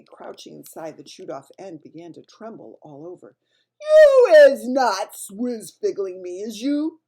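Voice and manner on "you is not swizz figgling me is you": loud, slow, threatening Bloodbottler; suspicious booming giant. A narrator's reading. Bloodbottler suspects the BFG of tricking him, loud and menacing. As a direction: loom over him with suspicion. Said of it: "said the blood bottler".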